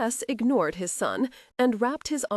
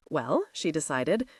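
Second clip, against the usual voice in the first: ordinary delivery, nothing marked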